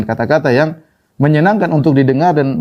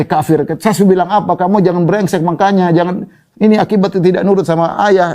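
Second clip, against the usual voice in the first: higher, harsh scolding of a stubborn son; mock scolding father; 6 semitones higher than usual. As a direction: tell him off as a bitter father would. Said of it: "Dia tidak mengatakan". (ayah memarahi anak yang membangkang, nada marah pura-pura)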